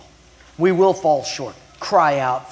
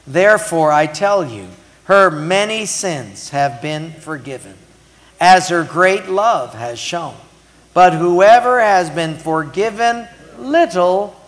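slow, loud, declaimed Bible verse; preacher leading a reading, raised and deliberate; emphatic scripture reading; 3 semitones higher, 7 dB louder than usual. Acted. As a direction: read the verse out slowly and forcefully, leaning on the key words. Reads the scripture aloud.

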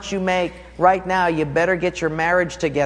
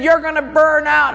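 high, loud warning shout; friend shouting; 12 semitones higher, 6 dB louder than usual. Retold or imitated. Friend warns the runner from behind, high loud shout. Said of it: "He said".

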